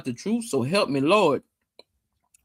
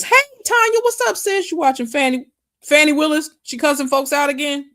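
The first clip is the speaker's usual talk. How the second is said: excited greeting; 10 semitones higher, 4 dB louder than usual. Real, not acted. Greeting a friend joining chat.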